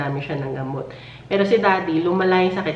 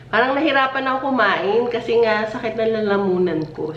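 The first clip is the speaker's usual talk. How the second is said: normal talking voice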